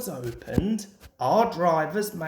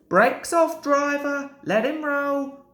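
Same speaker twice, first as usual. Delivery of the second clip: inspector ordering; 9 semitones higher, 3 dB louder than usual. A narrator's reading.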